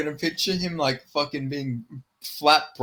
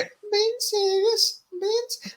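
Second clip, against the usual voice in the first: very high, drawn-out Scouse impression; Darren Till. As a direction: stretch it out in a squeaky mock-Scouse accent. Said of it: "how I have to transition into my till".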